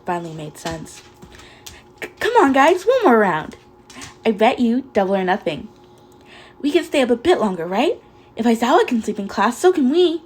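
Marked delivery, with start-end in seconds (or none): 2.0-10.2 high, loud eager begging; pleading. Acted. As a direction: beg them brightly to stay. Mina pleading to stay up.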